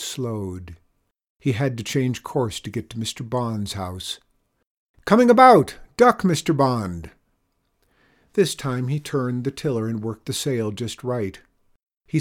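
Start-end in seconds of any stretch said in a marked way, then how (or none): 5.1-7.1 high, loud sailing call, easing slightly; shouted warning. A narrator's reading. Chester warning Mister Bond as the boat turns, shouting. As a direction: call it out hard over the wind. Sailor calling a warning to passenger.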